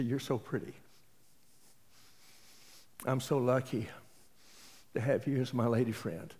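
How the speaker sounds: soft, hushed sweet talk to his lady friend; tender compliment. Retold or imitated. Husband softly telling his lady friend she's pretty, tender. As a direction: murmur it fondly.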